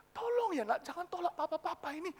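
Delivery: high, thin, hesitant plea; reluctant pleading. Acted. Father begging his child not to refuse, timid pleading. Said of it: "nadanya sungkan".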